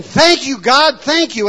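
loud, high cry of thanks; overjoyed shout of gratitude; joyful yell. Retold or imitated. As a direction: shout your thanks to God. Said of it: "he comes back to Jesus yelling".

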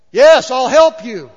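slow loud voice of God from the sky. Retold or imitated. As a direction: speak as the Lord from above, slowly. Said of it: "in a voice that I can't really imitate".

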